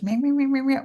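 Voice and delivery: mock the nag, pitch climbing; mocking nagging parent. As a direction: mimic a droning parent's nag.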